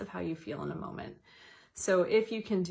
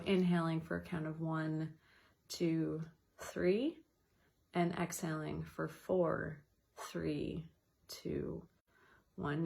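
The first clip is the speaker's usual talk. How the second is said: counted breathing; slower than usual. Shown as a demonstration. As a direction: guide the breath count gently, fading. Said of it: "extend your exhale one or two seconds".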